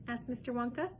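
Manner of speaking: plain reading voice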